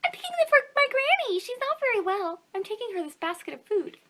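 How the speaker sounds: put-on a bright little girl talking to the wolf. The girl tells the wolf about her sick granny.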